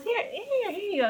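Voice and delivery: high-pitched acted handover; Irvin. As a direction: lightly mimic a man offering something. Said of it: "He goes".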